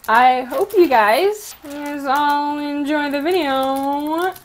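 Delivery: stretched-out words, one at a time; slow dictation. Real, not acted. Vlogger typing a sign-off for viewers, slow dictation. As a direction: draw each word out while typing. Types her sign-off aloud.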